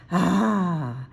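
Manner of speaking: usual speaking voice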